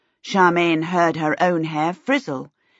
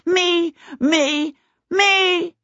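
put-on high, imperious toddler cry; booming toddler demand; 12 semitones higher, much slower than usual. A narrator's reading. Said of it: "imperious hand and boomed".

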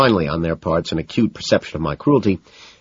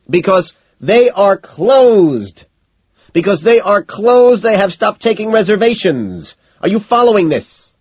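high, loud, drawn-out tirade; exasperated scream; 8 dB louder, slower than usual. A narrator's reading. McDermott explains the restaurant is closed, exasperated scream. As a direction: spell it out slowly at screaming pitch.

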